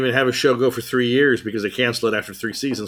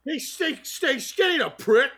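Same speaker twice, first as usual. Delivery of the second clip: much higher mock English voice, laughing; high hammed British bluster; mock Bale; 11 semitones higher than usual. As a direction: boast about weight like a pompous star. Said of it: "Bale parody".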